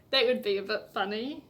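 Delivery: ordinary delivery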